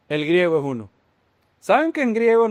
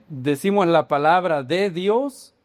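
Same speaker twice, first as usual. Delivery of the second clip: usual speaking voice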